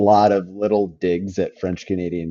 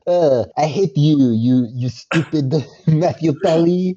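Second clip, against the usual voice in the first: higher, louder hammed French Canadian accent; mock French Canadian accent; 7 semitones higher, 5 dB louder than usual. Imitating Rosanna Arquette's character, bad French Canadian accent. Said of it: "woeful French Canadian attempt".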